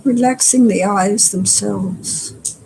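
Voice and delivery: unhurried meditation guide with long pauses; guided meditation. Acted. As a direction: guide them gently through relaxing, phrase by phrase. Leads a body-scan meditation.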